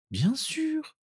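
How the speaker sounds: put-on very high, soft girl's voice; reassuring. Narcissa promising to stay, reassuring. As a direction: soothe him high and gently.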